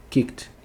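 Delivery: "Kicked" said kikt; no vowel before the final T in "Kicked". Shown as a demonstration. Teacher confirming the odd-sounding form, "Kicked" as kikt. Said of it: "é dessa forma".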